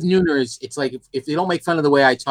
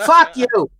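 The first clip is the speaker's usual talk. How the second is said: mock angry; 12 semitones higher than usual. Mock outrage at Boston jab.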